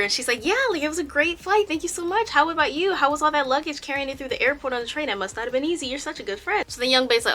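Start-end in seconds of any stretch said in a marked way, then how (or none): 0.4-6.6 higher-pitched gushing thanks; delighted, grinning. Retold or imitated. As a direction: gush with a big audible smile. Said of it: "cheesing from ear to ear".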